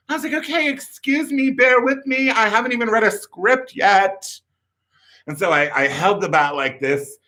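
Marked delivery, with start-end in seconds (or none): 0.3-4.3 high-pitched mock indignation; huffy retort. Would-be actor excusing himself to the director, huffy. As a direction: protest high, like an offended young actor.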